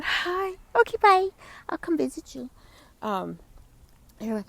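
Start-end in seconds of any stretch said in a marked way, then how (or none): 0.0-2.5 much higher, louder pet-talk goodbye; high cheerful goodbye to a kitty; cooing goodbye. Real, not acted. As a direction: sing a quick hi and bye to a cat. Greeting then leaving the cat.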